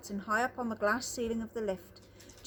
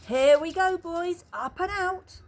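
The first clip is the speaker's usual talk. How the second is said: gleeful; 7 semitones higher, 7 dB louder than usual. Acted. Wonka launching the lift.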